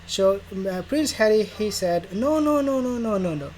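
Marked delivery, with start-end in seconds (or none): 2.1-3.5 mock denial. Harry voiced denying.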